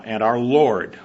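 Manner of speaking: "Lord" said slowly and leaned on; stress on Lord. Shown as a demonstration. Preacher to congregation, leaning slowly on "Lord". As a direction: draw out and weigh the word "Lord". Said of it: "I want to emphasize the word Lord".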